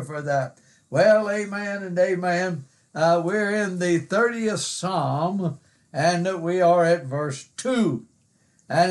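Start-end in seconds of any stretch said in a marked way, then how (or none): none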